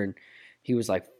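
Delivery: ordinary talk, nothing marked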